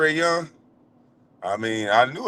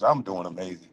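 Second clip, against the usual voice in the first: smug and satisfied; 9 semitones lower, 6 dB quieter than usual. Real, not acted. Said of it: "Y'all can hear it in my voice".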